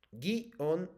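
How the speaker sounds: "Guión" broken into gui and on; split syllables. Shown as a demonstration. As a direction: sound out "Guión" in two separate beats. Teacher sounds out word for pupil.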